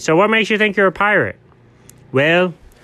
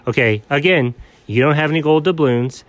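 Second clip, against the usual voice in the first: ordinary talk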